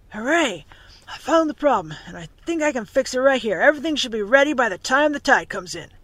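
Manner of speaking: cheering, voice lifted; excited mechanic. A narrator's reading. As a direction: call out good news to the crew. Mechanic announces he found the problem.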